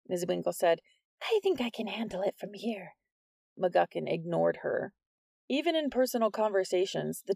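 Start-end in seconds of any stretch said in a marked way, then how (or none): 1.2-2.9 lightly voiced teacher, softer; a touch of nervous teacher. A narrator's reading. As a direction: politely push back, softer.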